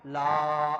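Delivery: لا stretched to a full alif; long madd on لا. Shown as a demonstration. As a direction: hold the vowel of لا for two beats. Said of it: "اس کو پڑھیں گے".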